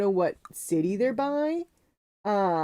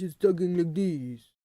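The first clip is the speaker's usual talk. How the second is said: put-on French accent from the front of the mouth; exaggerated French accent; mock French accent. Shown as a demonstration. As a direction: caricature a French speaker's accent. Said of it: "get close to sounding like I speak French".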